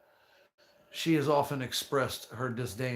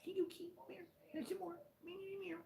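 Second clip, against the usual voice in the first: very high, soft cooing pet voice; baby talk to cat; 9 dB quieter than usual. Acted. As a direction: coo at the kitty like a baby.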